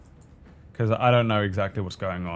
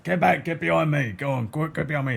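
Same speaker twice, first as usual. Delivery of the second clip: higher-pitched urgent call to refugees; raised, urgent in-character warning; shouting orders; 7 semitones higher than usual. Acted. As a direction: call out quickly to herd people back. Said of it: "I'm shouting at the refugees".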